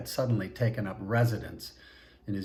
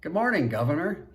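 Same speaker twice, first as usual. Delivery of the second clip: sing-song lilt; 7 semitones higher, 3 dB louder than usual. Acted. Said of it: "with a sing-song lilt".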